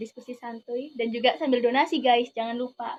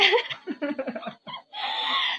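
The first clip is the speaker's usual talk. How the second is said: high giggle; 9 semitones higher than usual. Real, not acted. Laughs at own remark.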